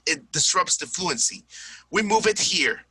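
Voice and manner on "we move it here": "move it" in two syllables for moved, pitched up; mispronounced "moved". Shown as a demonstration. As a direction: stress "move it" as a wrong moved. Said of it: "it doesn't sound right".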